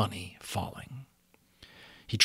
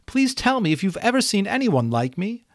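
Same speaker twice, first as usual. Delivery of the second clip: much higher, louder pleading unicorn; 12 semitones higher, 7 dB louder than usual. A narrator's reading. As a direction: beg him for an answer. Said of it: "she pleaded".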